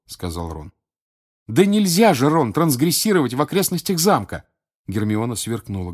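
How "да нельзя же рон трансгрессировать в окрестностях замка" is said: высокое, громкое раздражённое возражение; одёрнуть друга, повторяющего глупость; раздражение. Acted. Гермиона одёргивает Рона, сверкая глазами. (раздражённо, с нажимом, повысив голос)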